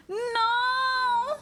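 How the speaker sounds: very high, heartbroken cry; anguished wail. Real, not acted. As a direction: wail in protest at the breakup. Streamer reacts to the breakup.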